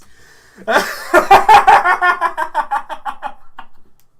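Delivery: high, loud long laughing fit; loud laughter. Real, not acted. Streamer cracks up after his impression, loud high laughter. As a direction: burst out laughing at full volume.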